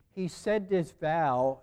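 slow, with vow leaned on hard; slow and weighty, vow pressed down; stress on vow. Shown as a demonstration. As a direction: lean into the word vow. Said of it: "notice I put an emphasis on the word vow".

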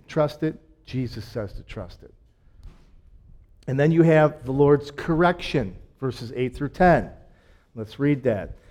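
ordinary delivery

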